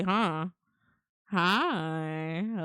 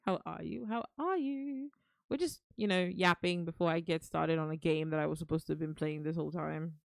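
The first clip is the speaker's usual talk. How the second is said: ordinary talk, nothing marked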